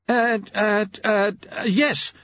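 high, stammering hesitation; high-voiced, rattled clockmaker; flustered. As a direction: dither nervously before agreeing. Jeremy flustered by protocol.

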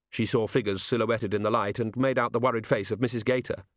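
plain reading voice

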